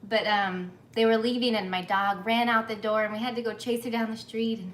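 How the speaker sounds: ordinary talk, nothing marked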